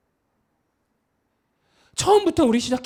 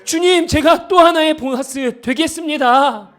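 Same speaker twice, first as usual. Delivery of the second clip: vow proclaimed; 5 semitones higher, 5 dB louder than usual. Shown as a demonstration. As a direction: proclaim it to the Lord as a full commitment. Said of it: "아멘으로 화답해 보겠습니까".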